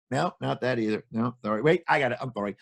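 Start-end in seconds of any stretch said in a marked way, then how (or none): none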